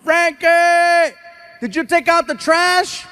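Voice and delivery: high, drawn-out call through the house; retold yell. Father yelling to son about the trash, mock holler. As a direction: holler it like a parent to another room. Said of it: "and I yell".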